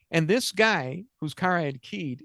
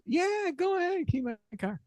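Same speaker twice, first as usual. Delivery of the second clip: eager car owner; 13 semitones higher than usual. Retold or imitated. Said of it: "He goes".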